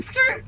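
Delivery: very high-pitched imitated skid noise. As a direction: squeal it like wheels swerving. Imitates board swerving around him.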